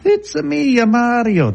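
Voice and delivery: high, mock Italian accent; hammed-up Italian video-game plumber; Mario. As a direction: sing out a cartoonish Italian accent. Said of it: "that's more Italian".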